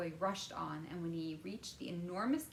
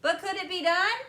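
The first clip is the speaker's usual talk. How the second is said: put-on high, excited boy's voice; eager boy; 12 semitones higher, 13 dB louder than usual. A narrator's reading. Boy quizzing Wonka about television, eager and high. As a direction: pester him with questions, higher and louder.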